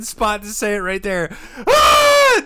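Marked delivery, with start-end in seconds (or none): 1.7-2.4 very high, loud cheer; crowd roar. Retold or imitated. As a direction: let out a triumphant yell.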